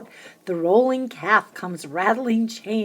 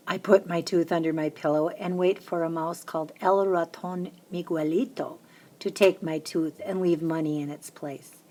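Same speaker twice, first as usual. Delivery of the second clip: ordinary delivery, nothing marked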